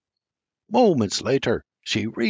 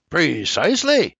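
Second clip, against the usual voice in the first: mild snarl; 6 semitones higher, 3 dB louder than usual. A narrator's reading. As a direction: let Precisely come out as a mild snarl. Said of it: "he snarls".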